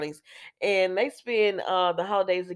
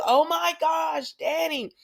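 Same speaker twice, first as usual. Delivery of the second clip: excited oh my gosh; 9 semitones higher, slower than usual. Retold or imitated. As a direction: draw out a happy shout of surprise. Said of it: "and he's like".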